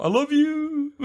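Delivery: high, laughing farewell cry; hammed-up last words as she falls; mock dying words. Falling off the cliff to her death, hammed-up high farewell.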